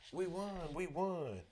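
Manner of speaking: high, loud cheer of victory; gleeful shout. A narrator's reading. Bandmates behind the narrator after winning, shouting gleefully. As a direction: shout it out in triumph. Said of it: "shouting gleefully behind me".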